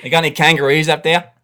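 put-on broad Aussie accent, chatty; Australian accent. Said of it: "such a brilliant Australian accent".

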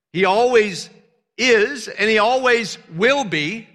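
loud, high, fervent preaching; preaching at full force. Real, not acted. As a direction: preach it with full fire to the congregation.

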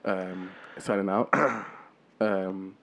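low, soft hip hop host sign-off; cool radio-host murmur, hip hop style. Pretend. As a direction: put on a laid-back, low hip hop voice. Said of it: "my hip hop voice on".

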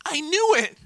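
high, loud gleeful squeal; smug delight. Pretend. Churchgoer hearing praise, with a high smug squeal. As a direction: squeal it like you always knew. Self-satisfied quote.

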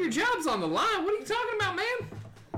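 high-pitched comic disbelief; incredulous. Acted. Friend scoffs at a drunk man's job fear, high incredulity. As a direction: swoop way up as if it's absurd.

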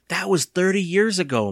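protesting. A narrator's reading. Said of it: "Mister Ross protested".

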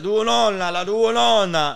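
high, loud Italian cabbie voice; old cab driver. Retold or imitated. Old cab driver talking to him, loud Italian old-man voice. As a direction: boom it like an old Naples driver. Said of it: "He was like".

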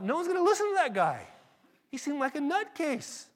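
high, loud comic incredulity; exasperated disbelief. Retold or imitated. Preacher recalls judging the street evangelist, high-pitched and exasperated. Retold exasperated thought about the zealot.

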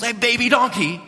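high, disbelieving question; incredulous disciples. Retold or imitated. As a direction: sound stunned by the choice. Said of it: "you could just imagine the disciples".

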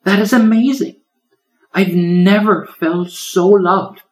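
high, bright-eyed little turtle in awe; a small creature overwhelmed with love; tiny turtle voice. Acted. Little turtle tells the old lion, high and awed.

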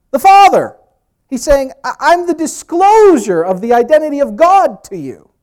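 high, loud proclamation; booming declaration, pitched high; full-force preaching. Acted.

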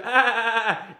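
high, loud mock dolphin chatter; dolphin sound effect. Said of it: "he goes".

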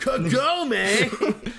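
high excited shout; anime shout. Acted. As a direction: call out like an anime hero.

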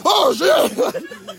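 high, loud startled curse; sudden alarm. Retold or imitated. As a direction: caught napping on the job. Guardian angel waking up.

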